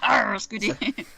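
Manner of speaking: a slow, drawn-out pirate "Arr" with a mock accent; pirate growl. Host plays along with her co-host's pirate bit, a slow mock pirate accent. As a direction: stretch the Arr like a cartoon buccaneer.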